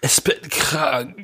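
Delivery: slow, drawn-out mock sick voice; a pitiful invalid's moan, done in jest; hammed-up sick voice. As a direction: play up being ill, slowly. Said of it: "klingst für mich jetzt nicht krank".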